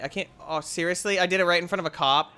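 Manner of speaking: can't believe it, rushed and loud; outraged disbelief. Real, not acted. Gamer stunned at being killed near police.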